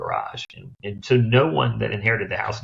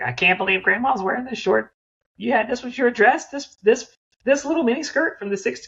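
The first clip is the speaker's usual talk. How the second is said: put-on much higher, excited young granddaughter. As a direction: squeal it like a shocked teen going through grandma's closet. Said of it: "they'll be like".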